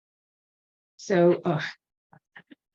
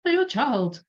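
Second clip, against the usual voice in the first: put-on second try at Julia Child, pitched up high; high, warbly Julia Child attempt, still rough; a hint of Julia Child, pitched high; Julia Child impression; 8 semitones higher than usual. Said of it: "I'll stop trying to do Julia Child's accent".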